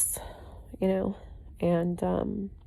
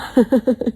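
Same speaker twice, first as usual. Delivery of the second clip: bright laugh; 7 semitones higher, 5 dB louder than usual. Real, not acted. Laughing after her whoop.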